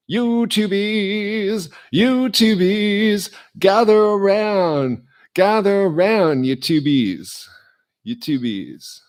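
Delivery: high, loud drawn-out call; slow sing-song crowd call. Acted. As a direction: summon the fans from afar. Calls fans to gather.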